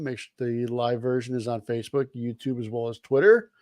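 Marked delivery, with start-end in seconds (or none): none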